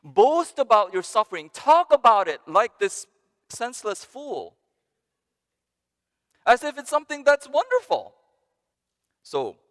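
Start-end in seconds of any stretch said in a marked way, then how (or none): 0.0-8.1 high, loud, gleeful bragging; mock boasting. Preacher voices the passage urging boasting, loud high gleeful bragging. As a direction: show off your suffering like a prize. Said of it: "perhaps this passage is saying".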